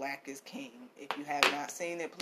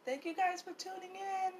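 voicing baby Yoda; 10 semitones higher, 7 dB quieter than usual. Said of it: "He's saying".

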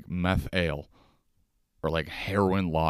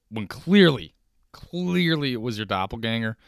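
ordinary delivery

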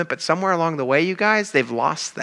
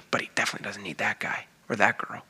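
whisper; 7 semitones lower, 8 dB quieter than usual. Retold or imitated. Smug believer's private thought.

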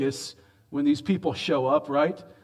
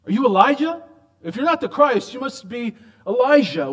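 pressing question; 8 semitones higher, 6 dB louder than usual. Retold or imitated. Delegation pressing John.

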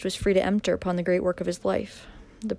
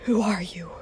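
whisper. Acted. Cornered villain, stunned.

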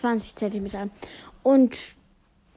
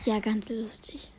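slow soft whisper; 3 semitones lower, 7 dB quieter, slower than usual. Real, not acted. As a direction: whisper it slowly aside.

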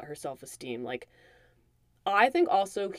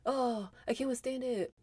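higher, softer, a hammed-up tragic sigh of a sulking husband; mock melodrama; 6 semitones higher, 7 dB quieter than usual. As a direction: play the wounded diva, lightly mocking. Mocking Richard's dramatic sulking.

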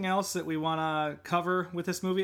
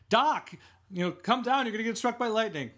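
mock Marty warning; 8 semitones higher, 3 dB louder than usual. Marty calling up to Doc.

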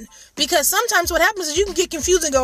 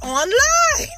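slow, higher, drawn-out ONLINE; drawn-out reveal; 10 semitones higher than usual. Real, not acted. Single mom revealing where she tried dating, drawn-out reveal. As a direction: stretch out ONLINE teasingly.

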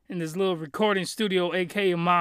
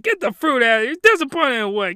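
indignant; 6 semitones higher, 6 dB louder than usual. Acted. Mocking reviewers online.

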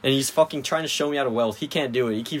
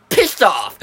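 higher, louder imitated exclamation; mock teacher outburst; 8 semitones higher, 6 dB louder than usual. Students mimicking the teacher's first swear, mock outburst.

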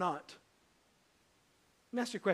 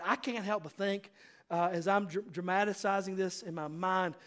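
ordinary talk, nothing marked

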